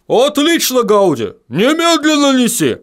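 high barked order. A narrator's reading. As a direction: bark the command. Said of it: "рявкнул Баддони".

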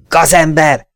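high angry shout of scoundrel; shouted insult. A narrator's reading. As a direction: spit out the insult at him. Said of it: "kiáltotta".

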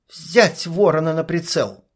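ordinary delivery

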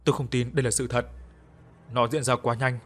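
usual speaking voice

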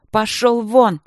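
high, loud order to leave; distraught shout. A narrator's reading. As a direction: cry out in turmoil. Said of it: "крикнула она в смятении".

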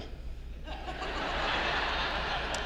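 breathless high giggle; airy giggle. Retold or imitated. Wife laughs at her own answer in counseling, soft giggle. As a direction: let out a soft fond giggle.

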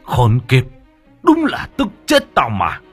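loud, high-pitched enraged cursing; furious outburst. A narrator's reading. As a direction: curse and let it rise into a shout. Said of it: "chú đang vô cùng tức giận". (tức tối gào lên)

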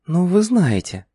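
put-on high, sly young woman's voice; sly whisper. A narrator's reading. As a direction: tease him, hushed and coy. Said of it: "лукаво прошептала она". (лукавый шёпот)

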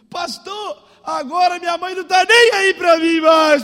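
high, loud wail of an upset girl, imitated; mock crying wail. Girl whining to pastor that mother ignores her, mock wailing. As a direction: bawl the complaint, hammed-up.

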